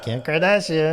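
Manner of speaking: mock celebrity phone greeting; Kim Kardashian. Co-host imitates answering the phone, put-on celebrity voice. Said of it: "you pick up the phone like".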